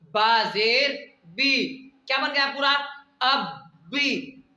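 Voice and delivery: very slow, syllables drawn out, बा जेर बी then अब बी; slow spelled drill. Shown as a demonstration. Teacher drills the reading slowly for students. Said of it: "आवाज को अंदर रख करके ही पढ़ना है".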